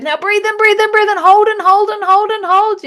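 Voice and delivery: high, loud driving commands; energetic breath cues. Shown as a demonstration. Teacher voicing the Doctor Joe breath, high and loud. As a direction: drive the energy up. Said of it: "trying to get that energy going".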